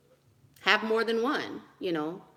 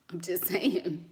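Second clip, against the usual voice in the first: whispered laugh; 2 semitones lower, 4 dB quieter, slower than usual. Real, not acted. Laughing aside after joke.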